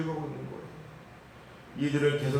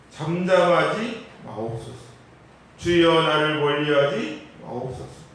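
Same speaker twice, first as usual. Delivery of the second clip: slow, loud pleading scripture read; impassioned psalm reading, building to a loud peak; fervent prayer reading; 6 dB louder, slower than usual. Acted. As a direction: cry out the psalm to God.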